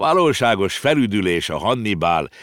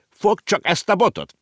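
much higher, sharp telling-off; scolding; 13 semitones higher, 4 dB louder than usual. A narrator's reading. Uncle scolds Konrád to hold the stick: sharp order. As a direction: snap an order at the boy. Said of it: "Ringelhut rászólt".

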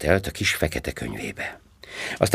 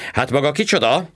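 high, pointed cop question; sharp question; 10 semitones higher, 3 dB louder than usual. A narrator's reading. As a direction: cut in sharply at the stranger. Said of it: "kérdezte élesen". (élesen, magasabb hangon)

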